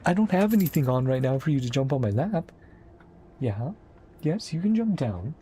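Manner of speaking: high, sing-song voice for a pet; high pet-talk voice to the cat. Real, not acted. Talking to the cat Rio.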